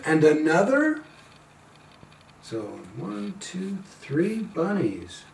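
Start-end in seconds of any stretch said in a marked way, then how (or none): none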